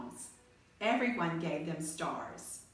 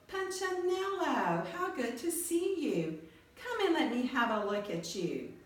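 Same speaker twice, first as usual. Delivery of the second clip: higher, glad welcoming character voice; Eli's voice; 7 semitones higher than usual. A narrator's reading. Eli welcoming the little Wemmick he made, higher glad voice. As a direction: greet him like a proud maker. Eli greets his creation.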